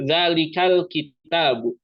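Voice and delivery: "zalikal" with its l said clearly and slowly; izhar demo. Shown as a demonstration. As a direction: spell out the lam in "zalikal" clearly. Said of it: "Jadi lamnya itu jelas, dibaca izhar". (contoh izhar, lam pada "zalikal" dibaca jelas dan pelan)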